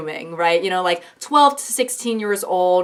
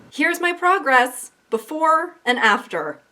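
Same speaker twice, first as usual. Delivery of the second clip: high, slow reading of a proud post; lightly voiced proud poster; mock poster; 8 semitones higher than usual. A vlogger reading a proud post, in a high slow mock voice.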